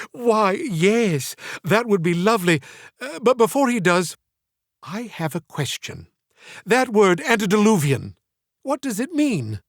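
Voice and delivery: lightly voiced genteel old woman, coy; elderly Southern lady. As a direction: play the flattered old lady asking sweetly.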